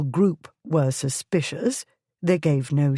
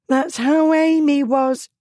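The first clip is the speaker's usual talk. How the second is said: high, louder anguished exclamation; upset cry; 11 semitones higher, 5 dB louder than usual. A narrator's reading. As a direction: blurt it out in alarm. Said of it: "Hannah cried".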